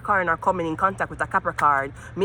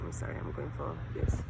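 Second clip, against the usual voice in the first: much softer whispered apology; whispered aside; 12 dB quieter than usual. Real, not acted. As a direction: whisper it off to the side. Aside unvoiced.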